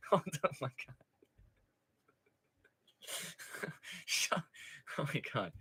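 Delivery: near-silent wheezing laugh; laughed out, barely voiced; gasping with laughter; breathless laughter. Real, not acted. Helpless laughter after chat.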